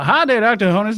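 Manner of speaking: ordinary talk, nothing marked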